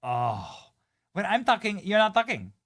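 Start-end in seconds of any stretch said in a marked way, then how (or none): 1.1-2.5 high, loud mock Spanish accent; mock her with a put-on Spanish accent, voice pitched way up; exaggerated fake Spanish accent, shrieky and scolding. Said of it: "In the fake accent".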